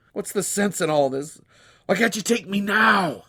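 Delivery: high, loud grief-stricken plea; anguished cry. Acted. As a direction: cry out for your dead son. Grieving father begs death.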